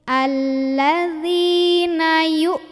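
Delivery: slow chanted "Alladzina", then "yu" stopped short; recitation with held hamzah. Shown as a demonstration. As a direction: draw out "Alladzina", clamp the sound shut after "yu". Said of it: "Suaranya ditekan, tertahan".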